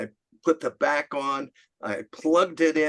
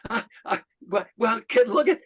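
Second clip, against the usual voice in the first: high, stammered words breaking into a laugh; choked-up mock hilarity, halting starts; imitating suppressed laughter; 8 semitones higher than usual. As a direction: act out trying to talk through a laughing fit. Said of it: "he just started laughing again".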